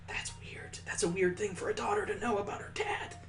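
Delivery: unvoiced whisper aside. Real, not acted. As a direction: whisper it like a secret comment.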